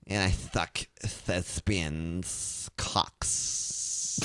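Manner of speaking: very slow, soft hammed-up declamation; hammy thespian. Playing the thespian to the co-host, slow and hammy. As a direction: drag it out like a ham actor. Mock dramatic thespian line.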